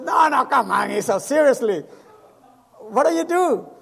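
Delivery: loud, pressing no, no, come on; disbelieving shout. Retold or imitated. Old friend Noel refusing to believe he is a pastor, loud disbelief. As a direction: push him to tell the truth, half laughing.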